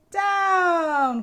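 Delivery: high, loud cry of down; cried. A narrator's reading. Witch calling the broom down, loud high cry. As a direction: call it out sharply. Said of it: "cried the witch".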